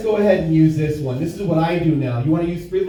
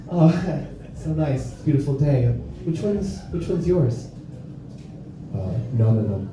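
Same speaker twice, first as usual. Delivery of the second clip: a hint of a low-voiced lurker musing; playground creep; 7 semitones lower than usual.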